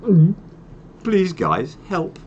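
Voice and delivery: high-pitched, hammed-up plea for help; mock pleading. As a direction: play at begging the insurers for help. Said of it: "having to ring our insurance company saying".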